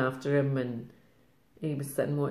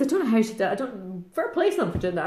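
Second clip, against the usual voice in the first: ordinary talk, nothing marked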